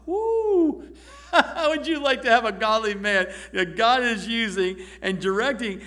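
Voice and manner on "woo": high, bright whoop with a laugh; delighted whoop. Real, not acted. Preacher thrilled by the verse, laughing whoop. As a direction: let out a joyful woo and laugh.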